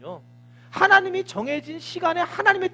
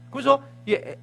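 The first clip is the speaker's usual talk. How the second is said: ordinary talk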